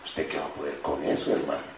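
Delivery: low, soft put-down. Acted. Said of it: "la voz negra está diciendo".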